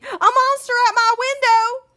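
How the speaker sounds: high, loud scared cry; frightened shout. Acted. Baby shouting in fright at the window. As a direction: panic at the window. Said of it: "shouted Baby".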